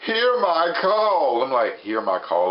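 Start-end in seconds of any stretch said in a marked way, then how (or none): 0.1-1.4 high, drawn-out dramatic invocation; hammed-up plea, pitched high and stretched slowly; summoning cry. Woman summoning demons for her dying husband, hammed-up summoning cry. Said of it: "she's summoning demons".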